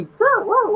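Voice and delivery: high mock puppy yips; playful very high woo sounds; imitating puppy noises. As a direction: yip like a bouncing pup. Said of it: "she jumping on me".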